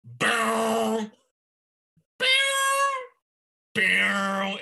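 high, loud mock sax blasts; voicing a giant saxophone; saxophone imitation. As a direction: honk out the big sax notes. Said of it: "he's just like".

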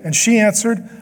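ordinary delivery

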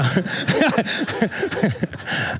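high, breathy burst of laughter; long helpless laugh at your own joke; real laugh. Real, not acted. Laughs at his own mock refusal.

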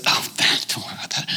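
whispered, throwaway reassurance; very soft, voiceless aside from a talking bird; hushed dismissal. Acted. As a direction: wave the worry away under your breath. Bird brushes off her grief.

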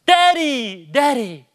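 high, loud imitated child's cry; desperate cry out. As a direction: call for a parent in mock desperation. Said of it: "most desperate times he cry-, cries out".

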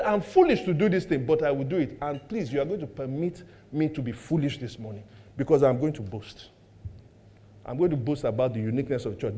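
very soft and low; low-key quoted confession. Acted. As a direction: quote it as a hushed, low admission. Quoting Paul's reluctant boast.

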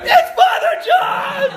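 high, loud, slow grown man yelling in mass; mock shout. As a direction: bellow it like a man losing it in church.

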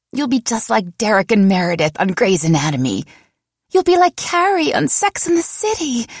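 put-on high, dreamy girlfriend voice rising to a squeal; picture her as a TV heroine, starry-eyed; swooning friend. Friend comparing her love story to a TV show, dreamy and high.

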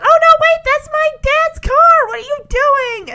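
very high, loud, alarmed; surprised shriek. Retold or imitated. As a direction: panic as the car goes. Becky surprised, voiced high.

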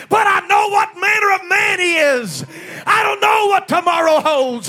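shouted, high revival sermon; preacher shouting to rouse the congregation; full-force preaching. Real, not acted. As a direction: proclaim it in ringing phrases, stretching the last words.

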